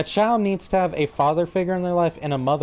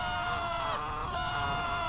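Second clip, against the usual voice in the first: high gleeful laugh; 12 dB quieter than usual. Real, not acted. Laughing while jumping.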